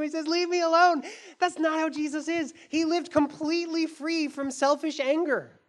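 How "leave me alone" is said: mild mock scream. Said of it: "Jesus screams at him".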